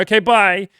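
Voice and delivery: rejected guy fleeing the woman, high and loud; panicked goodbye. Retold or imitated. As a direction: yelp it and bolt.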